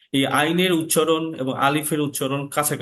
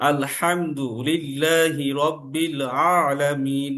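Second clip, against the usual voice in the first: "আলহামদুলিল্লাহি রাব্বিল আলামিন" forced and choked, very slow; over-squeeze the throat until it sounds suffocated; strained recitation; much slower than usual. Shown as a demonstration. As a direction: demo of a wrong, choking ain. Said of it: "কষ্ট হচ্ছে কিন্তু আমার".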